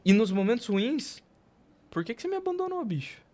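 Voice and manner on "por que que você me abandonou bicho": much higher, whiny cry to God; mock desperate plea. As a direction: plead like you've been abandoned. Imita a si mesmo clamando a Deus. (clamando a Deus de brincadeira, bem agudo)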